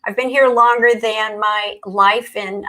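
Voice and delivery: ordinary delivery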